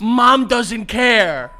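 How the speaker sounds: slow, loud dad scolding. Retold or imitated. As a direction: drag it out like a stern dad. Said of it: "things your dad has yelled".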